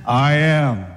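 low, assured declaration; man swagger. Acted. Jesus answering those come to arrest him, low swagger. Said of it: "He had the man swagger too".

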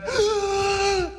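a high pained gasp for air; a crying, strained gasp; sharp agonized gasp. Acted. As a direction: gasp as if hanging from nails. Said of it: "took a deep breath".

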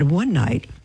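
ordinary delivery

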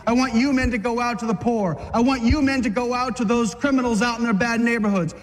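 ordinary talk, nothing marked

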